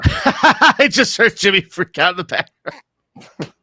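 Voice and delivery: talking through big laughter; laughing. Real, not acted. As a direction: laugh while speaking. Host laughing at cohost joke.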